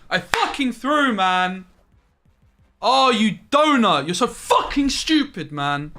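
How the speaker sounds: high, loud, slow raging yell at himself; furious shout. Real, not acted. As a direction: scream in real frustration at the blunder. Raging at own misplay.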